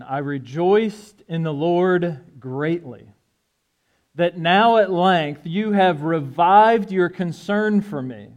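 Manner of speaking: deliberate, unhurried Bible reading; scripture reading. Acted. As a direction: read the passage aloud to the congregation. Reads scripture aloud at pulpit rate.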